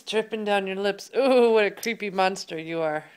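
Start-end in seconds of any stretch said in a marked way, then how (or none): 1.2-3.1 mock horror. Mother teasing kitty monster.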